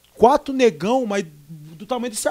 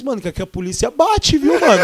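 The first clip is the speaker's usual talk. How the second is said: louder, laughing through it; laughing warning; 5 semitones higher, 7 dB louder than usual. Retold or imitated. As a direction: warn them while cracking up. (avisando rindo, mais alto)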